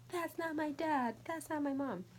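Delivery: funny high voice. Shown as a demonstration. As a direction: squeak out the lines like a little kid. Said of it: "It feels so weird saying these phrases".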